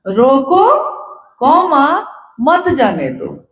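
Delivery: रोको lifted, comma spoken apart, then slow falling मत जाने दो; spoken punctuation. Shown as a demonstration. Speaker showing listeners how to write the order, dictating slowly with pauses. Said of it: "कैसे लिखा जाएगा".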